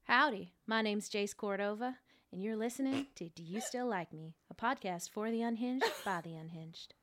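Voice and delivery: hammed-up American howdy accent; cartoonish cowboy accent. Shown as a demonstration. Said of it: "I am really good at accents".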